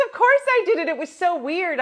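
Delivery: very high, loud gushing; excited. Retold or imitated. Friend retelling the funeral gig, high and loud.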